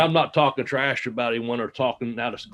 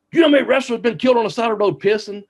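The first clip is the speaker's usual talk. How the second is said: higher, louder mock-serious outburst, fast; dead serious; 9 semitones higher, 5 dB louder, faster than usual. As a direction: act out an earnest warning, pitched up and quick. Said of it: "he's all dead serious".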